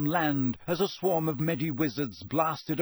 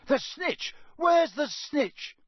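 high, urgent bellow, slowing down; Charlie bellowing; 12 semitones higher, slower than usual. A narrator's reading. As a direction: call it out along the row. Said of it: "bellowed Charlie along the row".

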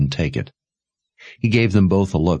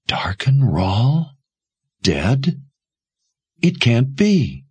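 slow, stunned disbelief; thunderstruck; 6 semitones higher, much slower than usual. A narrator's reading. Jensen hearing her hunter is dead, thunderstruck. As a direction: let the news sink in slowly, incredulous. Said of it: "was thunderstruck".

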